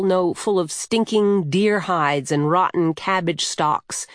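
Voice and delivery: usual speaking voice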